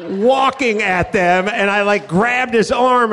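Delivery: normal talking voice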